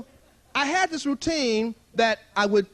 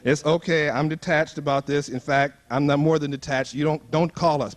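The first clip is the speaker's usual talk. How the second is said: mock detached prayer; 9 semitones lower than usual. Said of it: "I'm saying".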